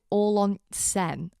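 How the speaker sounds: slow, loud mock Sheffield accent. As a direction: quote it in broad Sheffield. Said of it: "that's the most Sheffield sentence".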